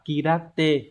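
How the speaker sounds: kirate, drawn out slowly, syllable by syllable; slowed model reading. Shown as a demonstration. Teacher slowly models kirate for exam students, syllable by syllable. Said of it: "Các phương án còn lại".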